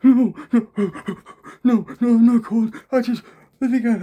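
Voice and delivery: high, loud, rushed no no no; frightened denial. Retold or imitated. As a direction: blurt it out panicked and fast. Frightened stranger denies being cold.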